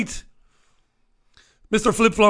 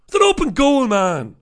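usual speaking voice

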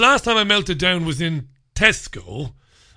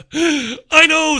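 exasperated retort; 11 semitones higher, 6 dB louder than usual. Retold or imitated. Retold retort to the vet.

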